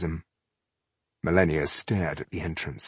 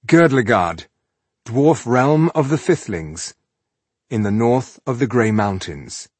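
chapter heading; 4 semitones higher, 6 dB louder than usual. A narrator's reading. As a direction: announce the new setting.

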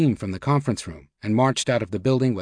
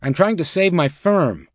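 high-pitched, heated insistence; exasperated protest; 6 semitones higher, 3 dB louder than usual. A narrator's reading. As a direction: protest as if pushed too far. Banker's exasperated outburst continues.

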